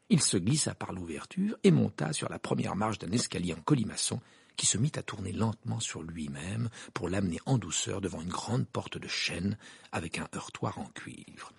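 usual speaking voice

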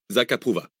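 plain reading voice

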